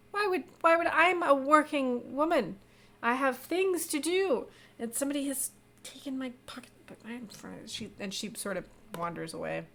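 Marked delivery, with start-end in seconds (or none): 0.1-7.7 high, put-on voice of a prickly working woman; flustered huffy woman. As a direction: huff through it, pretending all is well. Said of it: "She's like".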